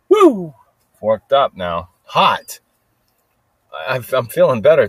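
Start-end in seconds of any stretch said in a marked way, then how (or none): 0.1-0.5 pumped-up whoop; excited whoop. Real, not acted. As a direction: let out a hyped-up woo. Hyping himself up.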